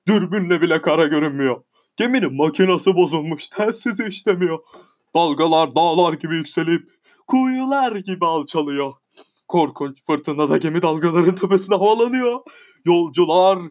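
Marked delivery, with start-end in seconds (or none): none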